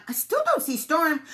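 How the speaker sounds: high, loud duck lookout; called out. A narrator's reading. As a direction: call the report down from above. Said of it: "Duck called on".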